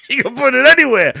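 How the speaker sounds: high, loud line broken up by real laughter; can't hold it together, laughing out the words; laughing through it. Real, not acted. Narrator cracking up while quoting Phil about Bobby, laughing loudly. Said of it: "I can't keep a straight face telling the story".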